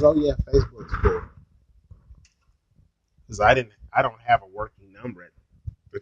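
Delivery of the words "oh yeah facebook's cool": light mock imitation of the woman, a touch higher; mimicking her. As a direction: echo her breezy reply in a lightly mocking voice. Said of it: "She goes".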